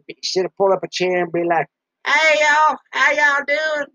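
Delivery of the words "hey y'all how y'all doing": imitating [name]. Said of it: "[name]".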